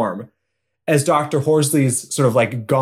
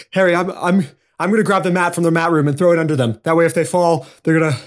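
hurried; 4 semitones higher, much faster than usual. Acted. Character hurriedly explains plan.

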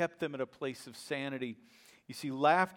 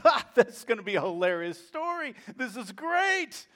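laughing delight; 8 semitones higher, 5 dB louder than usual. Retold or imitated. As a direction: chuckle it out, tickled by the news.

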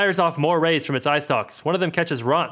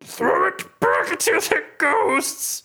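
Sereth voice; 14 semitones higher, much slower than usual. Acted. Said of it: "Sereth shakes his head".

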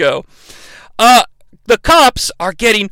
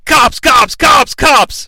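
yelling cops; 6 semitones higher, 3 dB louder, much slower than usual. Retold or imitated. Lookout warning racers over a walkie-talkie, urgent yelling. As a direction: shout the warning, higher and louder. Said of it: "someone yelling into a walkie-talkie".

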